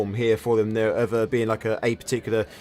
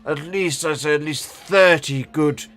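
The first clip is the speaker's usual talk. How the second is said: put-on old man voice; 3 semitones higher than usual. GM continues old wizard NPC.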